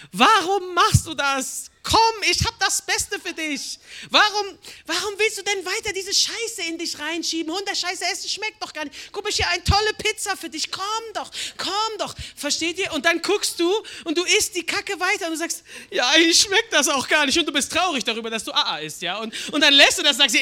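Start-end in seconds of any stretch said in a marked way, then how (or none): 0.1-12.2 sweet pleading call, much higher; loving coaxing God. Pretend. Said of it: "Oder sagt Gott". 15.9-17.4 sheepish admission. Pretend. Sheepish believer quote.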